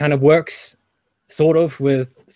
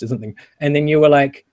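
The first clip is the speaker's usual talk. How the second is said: normal talking voice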